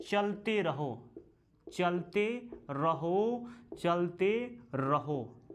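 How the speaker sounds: each word drawn out slowly for writing down; slow, deliberate teacher dictation. Shown as a demonstration. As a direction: dictate it slowly so students can copy. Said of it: "teacher dictating a sukta slowly".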